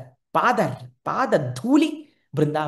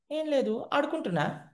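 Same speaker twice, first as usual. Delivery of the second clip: put-on innocent child; 9 semitones higher, 4 dB quieter than usual.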